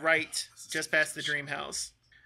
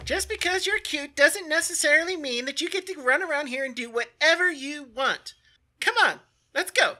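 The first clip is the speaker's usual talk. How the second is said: high, loud officious guard voice; scolding town guard; 11 semitones higher, 7 dB louder than usual. Acted. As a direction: scold them and hurry them along, pitched up high. Game master voices the guard.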